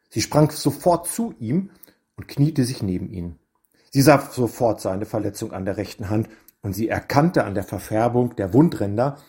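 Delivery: ordinary delivery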